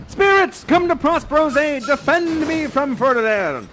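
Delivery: high, loud theatrical summons; calling for help. Acted. Prospero calling spirits against Ferdinand, loud cry for aid. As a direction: shout for backup in mock alarm.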